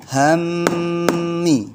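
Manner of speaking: hammi with the mm held, high and drawn out; held recitation. Shown as a demonstration. Teacher models reading for children, held chant. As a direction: chant hammi holding the double m. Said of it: "Bacanya bagaimana".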